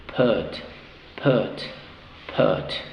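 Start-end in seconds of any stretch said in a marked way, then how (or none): none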